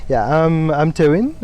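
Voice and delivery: ordinary talk, nothing marked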